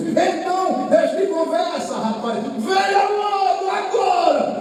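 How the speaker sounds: preacher shouting at a hesitant man to come now; shouted altar call. Retold or imitated. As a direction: shout him forward to the altar.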